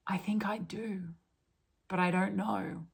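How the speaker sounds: whispered, unsure reply; hesitant whisper. Retold or imitated. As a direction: breathe it out uncertainly. Awakening person answers hesitantly.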